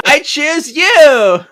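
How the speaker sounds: high, drawn-out shouted catchphrase; mock battle cry. Joking about a Pokémon trainer on the cover, mock battle cry. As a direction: bellow it long and high, laughing. Imitating the Ash Ketchum catchphrase.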